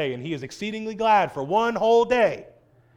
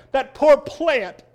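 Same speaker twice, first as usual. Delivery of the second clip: higher, sappy whimpering over the plant; mock pity; 7 semitones higher than usual. Preacher mocking Jonah pitying his plant, higher and whiny. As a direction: ham up a sorry-for-itself lament. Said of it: "He pities the plant".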